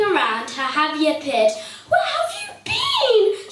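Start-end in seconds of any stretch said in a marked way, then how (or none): 1.9-3.5 high, frantic cry of a worried wife; tearful cry. Acted. Wife nightingale crying out at her late husband, high and frantic. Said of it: "she cried".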